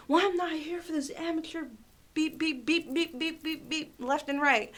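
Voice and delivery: high-pitched imitation of a swearing customer; retold outburst full of beeps; mock angry rant. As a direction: rant indignantly, bleeping out the swear words.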